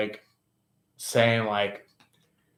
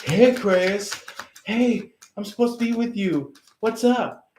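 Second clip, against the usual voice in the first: higher, lightly voiced pushy ex calling out; mock pushy suitor; 8 semitones higher than usual. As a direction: play the clingy third party butting in. Third party pleading with Aquarius.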